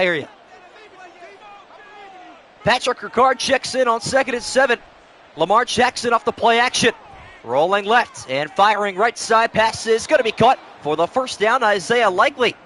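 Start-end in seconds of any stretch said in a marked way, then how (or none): 2.6-12.5 broadcaster building up on third down; play-by-play call, louder. Acted. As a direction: sell the big catch like TV.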